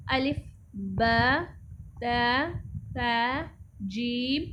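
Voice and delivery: slow recitation. Shown as a demonstration. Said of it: "teacher recites the letters slowly".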